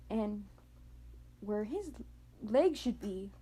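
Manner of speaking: ordinary delivery, nothing marked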